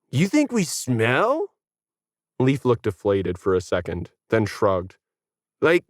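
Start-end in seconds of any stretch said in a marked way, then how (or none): 0.1-1.5 slow, higher, wounded; slow hurt surprise; hurt dude. Acted. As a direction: take it personally. Leaf deflated.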